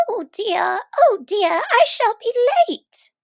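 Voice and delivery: high, anxious rabbit voice, rising as it panics; fretting rabbit. A narrator's reading. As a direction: squeak it out like a flustered rabbit running late. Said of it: "to hear the rabbit say to itself".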